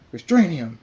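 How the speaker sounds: loud order. A narrator's reading. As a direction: bark the order to seize him. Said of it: "the pony says".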